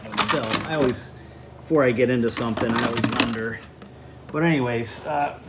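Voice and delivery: strained talk, pushing hard on a tool; straining voice while working under a car; effort grunt. Real, not acted. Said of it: "sorry for my grunting".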